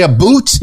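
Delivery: "a boot" said with an oo vowel, as Canadian "about". Shown as a demonstration. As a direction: rhyme the end of "a boot" with hoot. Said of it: "I just went Canadian there".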